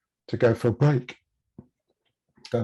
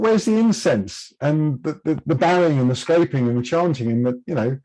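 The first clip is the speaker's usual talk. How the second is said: higher, louder, half-laughing mock outrage; mock indignation; 6 semitones higher, 5 dB louder than usual. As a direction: playfully complain about the missing ritual trappings. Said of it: "I felt a bit cheated".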